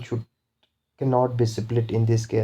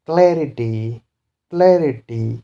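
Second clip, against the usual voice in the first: CLA-rity, punched first syllable in clarity; first-syllable stress; 4 dB louder than usual. Shown as a demonstration. Teacher shows a three syllabic word, stress on CLA in clarity. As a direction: hit CLA hard in clarity. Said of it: "stress will be on first syllable".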